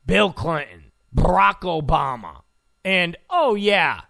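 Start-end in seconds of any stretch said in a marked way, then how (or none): none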